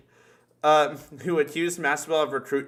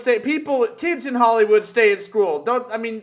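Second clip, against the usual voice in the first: higher, louder excited correction; excited shout; 9 semitones higher, 8 dB louder than usual. Real, not acted. Friends joking about kids in Hollywood, excited shout. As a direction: shout it out, laughing at the mix-up.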